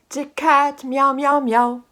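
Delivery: loud high cat meows; animal impressions. Shown as a demonstration. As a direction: meow like a cat for the baby. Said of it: "the story that I'm gonna sing".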